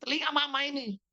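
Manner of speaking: high-pitched imitation of complaining mother; mock motherly complaint. Mother telling son not to shout, mock complaint. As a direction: imitate mama grumbling about noise.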